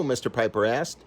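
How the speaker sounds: ordinary delivery, nothing marked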